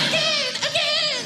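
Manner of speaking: put-on very high, slow little-kid pleading; child demanding. Child in the back seat begging for the song again. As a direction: beg like a small child.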